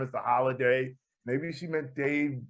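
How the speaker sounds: ordinary delivery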